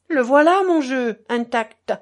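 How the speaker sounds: loud, high triumphant cry; triumphant shout. A narrator's reading. Innkeeper shows the sealed deck to everyone, shouting in triumph. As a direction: wave the proof and shout it out. Innkeeper exposes the marked cards.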